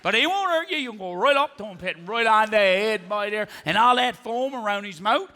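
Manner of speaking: put-on rambling local with a Newfoundland accent; chatty Newfoundlander. Newfoundland local gives a stranger endless pharmacy directions, rambling. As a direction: play a folksy, teasing Newfoundland storyteller. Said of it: "In Newfoundland, when you ask a question".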